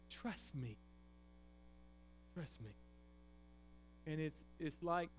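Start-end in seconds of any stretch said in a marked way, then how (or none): 0.1-0.8 hushed, pleading trust me; God softly asking Job to trust; soft plea. Acted. God's tender plea to Job. 2.3-2.7 even softer and lower, almost breathed; God repeating his plea to Job, barely audible; whispered-soft plea. Acted. God's tender plea repeated.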